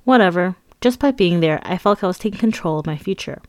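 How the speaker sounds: usual speaking voice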